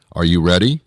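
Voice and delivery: ordinary talk, nothing marked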